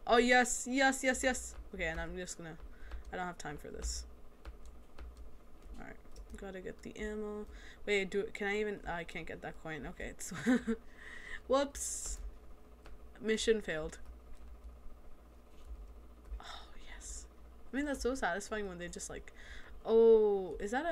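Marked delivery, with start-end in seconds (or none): 0.1-1.6 higher, eager run of yeses; pleased, raised-pitch exclamation; excited celebration. Real, not acted. Celebrating success in game. 16.4-17.2 breathed-out, voiceless yes; hushed little victory; whispered satisfaction. Real, not acted. Savouring a satisfying kill.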